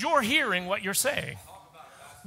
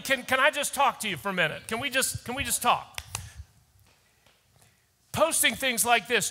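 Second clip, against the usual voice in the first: ordinary delivery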